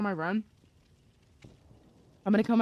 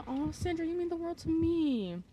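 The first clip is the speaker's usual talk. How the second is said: touched and gushing; 10 semitones higher than usual. Real, not acted. Streamer thanks a loyal viewer, touched and gushing. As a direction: draw it out high, full of affection.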